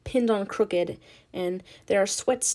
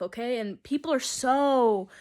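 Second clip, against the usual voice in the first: ordinary talk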